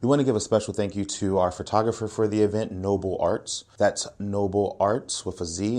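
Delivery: ordinary talk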